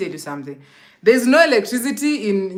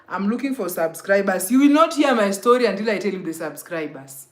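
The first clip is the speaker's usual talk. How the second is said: ordinary talk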